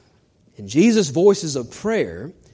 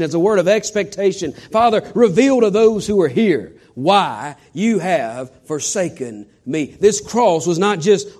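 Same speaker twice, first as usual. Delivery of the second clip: preaching at full force; 4 semitones higher, 3 dB louder than usual. Acted.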